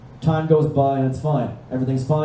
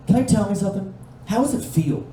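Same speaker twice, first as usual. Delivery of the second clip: higher, lightly voiced dazed daughter; glazed teenage girl; 6 semitones higher than usual. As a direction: mimic a spaced-out girl getting confiding. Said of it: "I can hear the glaze in her eyes".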